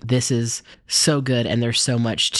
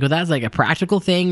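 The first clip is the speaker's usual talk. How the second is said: ordinary delivery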